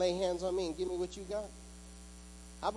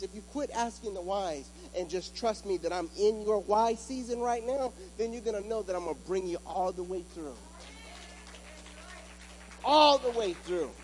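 full-force preaching; 4 semitones higher than usual. Acted. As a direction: hammer the promise at the congregation, voice ringing. Preaching God's answer.

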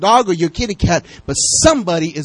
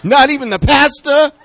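shout; 9 semitones higher, 7 dB louder than usual. Real, not acted. Joking shout at the congregation.

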